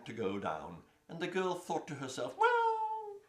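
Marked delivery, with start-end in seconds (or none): 2.4-3.2 put-on girl's voice. Girl voiced in story.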